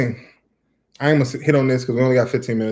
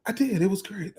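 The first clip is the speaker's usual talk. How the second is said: mimicking wife; 8 semitones higher, 5 dB quieter than usual. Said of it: "I'd be like".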